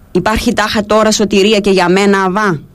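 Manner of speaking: ordinary delivery, nothing marked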